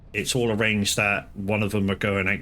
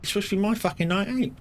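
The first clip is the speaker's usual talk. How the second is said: outraged protest, much higher; husband protesting his ruined night out, mock outrage; mock indignant; 12 semitones higher, faster than usual. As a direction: splutter in fake outrage. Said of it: "And it's like".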